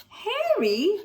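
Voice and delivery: high joyful call; cried out. Acted. Tom and Stella greeting Harry, high joyful cry. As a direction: call his name gladly. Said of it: "they cried".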